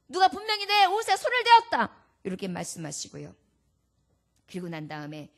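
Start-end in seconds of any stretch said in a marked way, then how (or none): 0.1-2.0 손을 hit high and loud, as an insistent call; louder insisting repeat. Acted.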